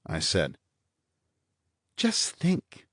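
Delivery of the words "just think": bright blonde. A narrator's reading. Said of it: "the blonde said brightly".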